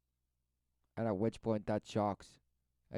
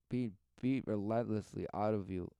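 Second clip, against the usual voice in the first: usual speaking voice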